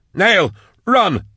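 high-pitched warning yell; urgent call, pitched right up. A narrator's reading. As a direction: yell a desperate warning to a comrade. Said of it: "Drusha yelled".

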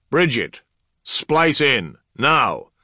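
loud, high, emphatic order; urgent command. Acted. Commander ordering an immediate repair, urgent. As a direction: push each order out with force. Commander urging adepts in crisis.

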